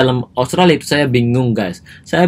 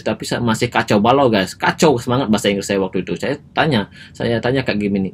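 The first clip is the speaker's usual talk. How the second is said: plain reading voice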